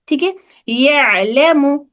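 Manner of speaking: यअलमु with ain from the mid throat, slowly; Arabic ain sound. Shown as a demonstration. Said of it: "दरमियानी हलक से अदा हो रहा है". (गले के बीच से ऐन)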